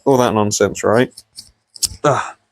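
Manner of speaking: normal talking voice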